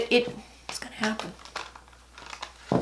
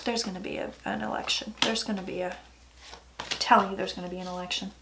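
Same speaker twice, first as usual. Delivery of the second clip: hushed; 5 semitones lower, 12 dB quieter, faster than usual. Real, not acted. Soft-spoken prediction.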